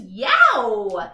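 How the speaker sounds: loud exclamation; a loud impressed yelp; impressed yow. A narrator's reading. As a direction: react like it's too cool. Exclamation at their coolness.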